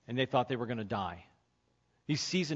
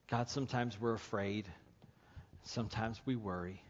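soft, low closing prayer; quiet prayer; 4 semitones lower, 6 dB quieter than usual. Real, not acted. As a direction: pray gently over the congregation.

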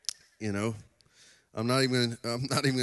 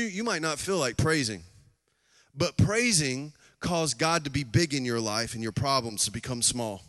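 ordinary talk, nothing marked

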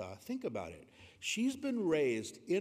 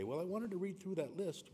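higher, quick, lightly acted reply; playful put-on reply, pitched up; mock eager answer; 6 semitones higher, faster than usual. Jokingly voiced answer to spouse.

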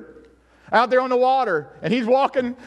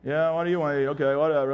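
bored customer service; 7 semitones lower than usual. Retold or imitated. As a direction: act like you can't be bothered to help. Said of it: "some of those customer service people".

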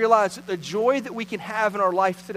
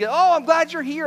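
higher, louder gushing welcome; excited dog voice; 7 semitones higher, 5 dB louder than usual. Retold or imitated. Pastor voicing his dog greeting a friend, hammed-up excited. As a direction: gush like a dog that forgot you left. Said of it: "Like, like, you, like".

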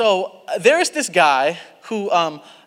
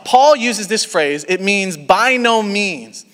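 ordinary talk

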